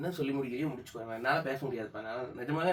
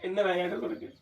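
tearful reproach; 5 semitones higher, 3 dB louder than usual. Real, not acted. Husband upset after prank.

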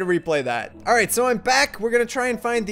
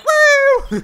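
high loud whoop; very high loud cheer; whooping bird; 10 dB louder than usual. Retold or imitated. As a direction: whoop with delight. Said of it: "He's like".

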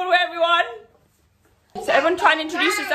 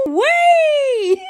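excited squeal; 14 semitones higher, 8 dB louder than usual. Real, not acted. Playful squeal.

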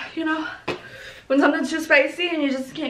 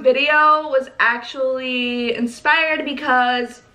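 ordinary delivery

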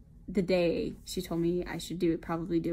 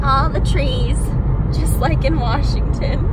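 excited shout; 6 semitones higher, 17 dB louder, slower than usual. Real, not acted. Loud excited call out.

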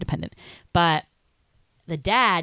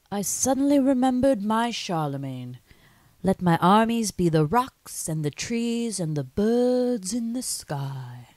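slow, grand Scottish Sean Connery; 3 semitones higher, slower than usual. Retold or imitated. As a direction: recite it in a Scottish brogue, slowly. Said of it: "I'm not gonna be able to do the Sean Connery".

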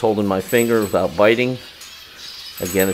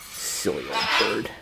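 slow, soft, lower coo; 3 semitones lower, 7 dB quieter than usual. Real, not acted. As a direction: coo it gently to the bird. Cooing to the parrot.

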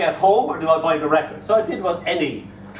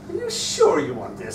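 doubtful bookseller. Retold or imitated. Doubtful bookseller quoted to child.

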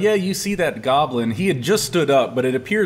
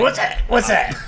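goblin voice; 8 semitones higher than usual. Acted. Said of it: "He goes".

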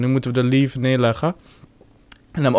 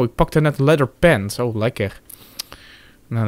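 ordinary talk, nothing marked

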